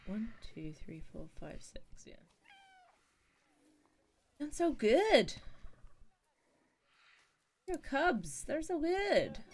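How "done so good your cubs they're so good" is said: much higher, louder pet-talk praise. Real, not acted. Streamer praising the cubs in high, gushing baby talk. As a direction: gush like praising a puppy.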